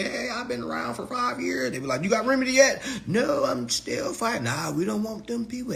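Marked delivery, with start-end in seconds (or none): 0.0-1.7 high-pitched mocking voice; imagined hanger-on. Member boasting of five years around, high mocking imitation. 2.0-5.7 high, loud mock dialogue; mimicked members' exchange. Members questioning someone still fighting, high-pitched mock dialogue.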